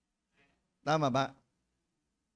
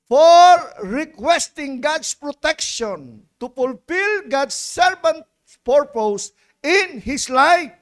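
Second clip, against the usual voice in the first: loud, slow emphatic preaching; rising preacher's cadence; 6 semitones higher, 9 dB louder, slower than usual. Acted. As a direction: drive each prayed home from the pulpit. Preaching at full force.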